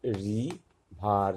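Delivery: River stretched very slowly; slow teacher model of river; slow drawn-out demo. Shown as a demonstration. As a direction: draw out river syllable by syllable. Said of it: "ইয়ের উচ্চারণটা আয়ের মতো আসছে".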